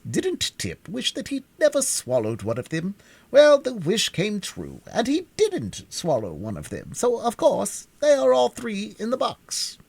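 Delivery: pompous bug, lofty and drawn out; high, slow, learned insect; Wogglebug voice. A narrator's reading. Wogglebug lectures Tip.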